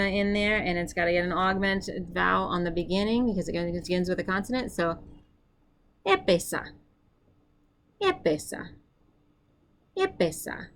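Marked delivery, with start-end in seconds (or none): 6.1-10.7 epesa said higher, clearly, to be repeated; high model pronunciation. Shown as a demonstration. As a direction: sing out epesa for the learners. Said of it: "Your turn".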